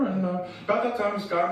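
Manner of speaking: ordinary delivery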